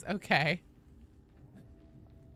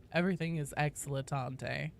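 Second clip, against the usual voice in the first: normal talking voice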